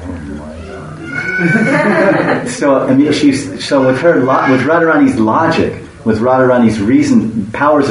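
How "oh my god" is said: high, very slow drawn-out gasp of disbelief; stunned. Acted. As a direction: let the shock stretch out high. Radharani shocked at impossible task.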